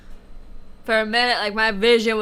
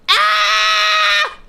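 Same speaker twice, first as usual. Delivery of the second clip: loud high scream of pain; 7 dB louder than usual. Real, not acted. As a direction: scream at the burn. Biting a hot chili.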